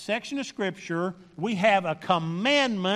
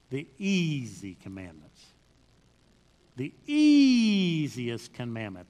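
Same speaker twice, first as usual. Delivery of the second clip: EASIEST stretched out long and sliding down; relishing EASIEST; 7 semitones lower than usual. Real, not acted. Preacher teases the congregation with the easy commandment, relishing EASIEST. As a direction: draw out EASIEST, letting the pitch fall away.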